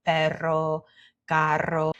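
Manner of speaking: "perro, carro" with trilled rr; "perro, carro" in Spanish, tongue trilling mid-word; rolled double R. Shown as a demonstration. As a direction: roll the middle R in "perro, carro". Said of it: "is our double R".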